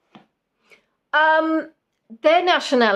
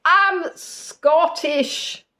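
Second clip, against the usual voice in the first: put-on higher, drawn-out Scottish accent; mock Scottish accent; 6 semitones higher, much slower than usual. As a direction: stretch it out in a hammed-up Scottish accent. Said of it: "but they would say".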